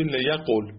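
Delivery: لیقول with the v dropped, short ending; final form liyaqol. Shown as a demonstration. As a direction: say لیقول as le-ya-qol.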